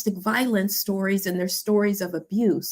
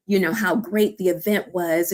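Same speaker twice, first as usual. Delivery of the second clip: normal talking voice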